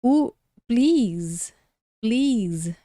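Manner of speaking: please with a long ee and final buzzing z; pronúncia de please. Shown as a demonstration. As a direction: say please slowly, ending in a voiced z. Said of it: "esqueci de falar". (please pronunciado com som de Z no final)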